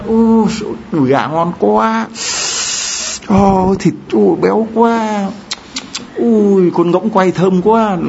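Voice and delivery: high, drawn-out cries of delight; mock yelling. Priest fooling outsiders over plain sweet potatoes, mock yelling. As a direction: cry out slowly as if feasting, high-pitched and hammed-up. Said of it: "mà tôi cứ hét".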